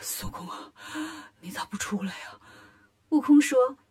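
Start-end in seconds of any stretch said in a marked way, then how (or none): 0.0-2.4 breathless whisper, much softer; out of breath. A narrator's reading. Dizzy lion demon pleads with Wukong, breathless whisper. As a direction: gasp it out, dizzy and spent. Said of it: "喘着气说".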